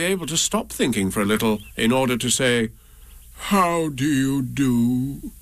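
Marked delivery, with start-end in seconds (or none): 3.5-5.3 slow, mournful donkey greeting; gloomy. A narrator's reading. Eeyore greets Pooh without cheer, slow and gloomy. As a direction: drag it out like a sad old donkey. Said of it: "in a gloomy manner to him".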